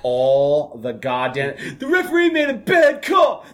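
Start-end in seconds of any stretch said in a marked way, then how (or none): 1.8-3.5 high, loud whine; whiny kid complaint. Retold or imitated. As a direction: complain like a sulky young player. Players complaining to coach.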